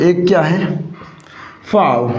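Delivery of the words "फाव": फाव as a single falling "faav"; correct pronunciation. Shown as a demonstration. Teacher introducing a word to students, फाव as one low syllable. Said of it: "इसका correct pronunciation क्या हो जाएगा".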